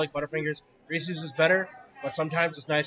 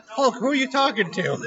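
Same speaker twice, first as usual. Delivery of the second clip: mocking confused question; 10 semitones higher, 6 dB louder than usual. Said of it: "He's like".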